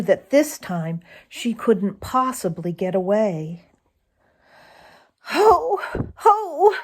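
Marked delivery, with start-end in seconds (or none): 5.3-6.7 high, sobbing ho ho, played for effect; a fox sobbing in despair, pitched up high; mock sob. Old Granny Fox sobs in despair, hammed-up high sob. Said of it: "sobbed old Granny Fox under her breath".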